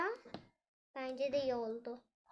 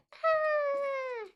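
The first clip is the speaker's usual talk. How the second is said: high, loud startled cry; a doll's piercing shocked yelp; surprised shriek; 13 semitones higher, 8 dB louder than usual. Pretend. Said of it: "Ne bağırıyorsun kanka?".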